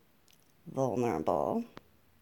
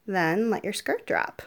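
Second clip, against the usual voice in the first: ordinary delivery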